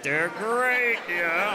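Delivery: coked-up Tony the Tiger. Acted. Comic mimicking a cereal mascot, coked-up hype. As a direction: belt it out like an over-hyped cereal mascot. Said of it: "Tony the Tiger's clearly coked up".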